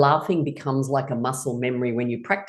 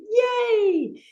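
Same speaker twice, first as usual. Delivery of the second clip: long, high squealed yay; childlike cheer. Shown as a demonstration. Teacher showing playful activity to class, childlike cheer. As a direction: cheer it out, silly and drawn out. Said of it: "A little bit childlike, silly, and fun".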